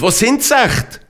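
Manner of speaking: high-pitched indignant question; loud scolding. A narrator's reading. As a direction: demand it crossly, pitch jumping up. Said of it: "schimpft er laut".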